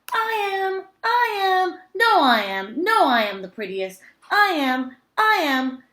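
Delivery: high, loud squabbling cat voices; all the cats crying over each other; clamouring cats. A narrator's reading. Cats competing to be prettiest, high and loud. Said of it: "cried hundreds and thousands".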